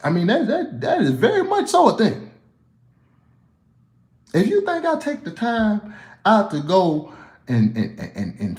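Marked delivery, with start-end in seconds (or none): 0.0-6.5 high, laughing through the words; laughing disbelief. Real, not acted. Laughing at fans who ask about his accent, high.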